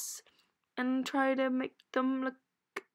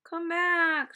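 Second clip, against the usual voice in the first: loud call, higher; 5 semitones higher, 5 dB louder than usual. Real, not acted. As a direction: call after someone leaving. Calling after Sophie.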